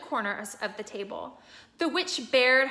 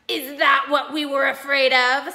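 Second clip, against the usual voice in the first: louder scornful question; mild mocking sneer; 3 semitones higher, 9 dB louder than usual. A narrator's reading. Another creature scoffing at Aslan, louder. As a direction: scoff at their own fear. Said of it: "said another".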